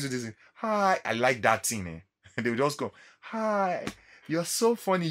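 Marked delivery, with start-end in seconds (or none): 0.5-1.0 high, cute imitation of a girl's hello; girly hi. Imitating girls' cute greeting. 3.2-5.1 high, cute girl's voice imitation; girly greeting. Imitating girls greeting him in passing.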